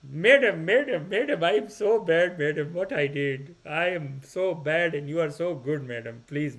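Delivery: put-on pleading mountain guide in an Indian accent; imitated Indian accent, contrite and repetitive; Indian guruji accent. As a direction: beg apologetically in a mock Indian accent. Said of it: "the, the mountain Guruji".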